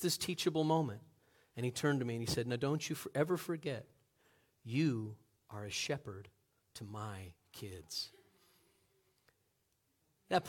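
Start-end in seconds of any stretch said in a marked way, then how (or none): 2.5-8.1 low, soft, slowing to a hush; solemn, quiet admonition. Retold or imitated. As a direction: drive the lesson home quietly. Farmer's solemn lesson to youth pastor.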